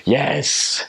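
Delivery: high, drawn-out cheer; acted burst of joy; delighted yes. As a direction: celebrate hearing a long-missed voice. Retelling delight at hearing accent.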